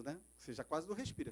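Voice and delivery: normal talking voice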